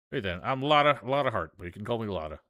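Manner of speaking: obliging Southern country woman; friendly Southern photographer. Acted. Plays Southern photographer introducing herself.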